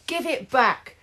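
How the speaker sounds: high, insistent shout. Acted. Ian to Ralph over the pen, high shout. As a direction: demand the pen back. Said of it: "shouted Inky Ian".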